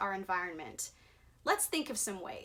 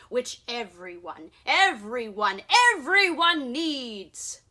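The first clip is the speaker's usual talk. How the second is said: building defiant shout, louder at the end; angry yell; 6 dB louder than usual. Acted. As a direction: stand your ground and yell. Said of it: "I yelled at the Lorax".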